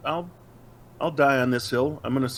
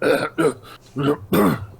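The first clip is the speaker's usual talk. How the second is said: a hacking cough as if spit is stuck; throat-clearing cough; 2 semitones higher, 3 dB louder than usual. Retold or imitated. Batman chokes on spit mid-line, hacking cough. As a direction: cough up something caught in the throat. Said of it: "a hunk of spit caught in his throat".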